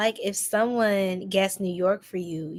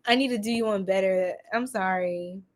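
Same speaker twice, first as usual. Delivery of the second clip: normal talking voice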